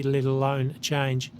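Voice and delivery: ordinary delivery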